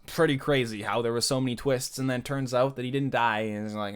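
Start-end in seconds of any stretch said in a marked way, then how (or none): none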